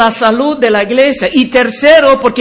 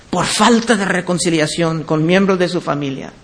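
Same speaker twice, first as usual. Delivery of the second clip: ordinary delivery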